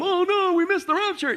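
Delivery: high, whiny mock panic; mock freaking out. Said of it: "they're freaking out".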